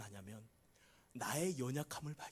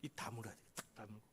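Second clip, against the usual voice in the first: much softer, lower, sealing the lips; hushed; 6 semitones lower, 13 dB quieter, faster than usual. Shown as a demonstration. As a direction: drop to a hush as if closing your mouth. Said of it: "그 입". (낮고 아주 조용하게 입 다무는 듯이)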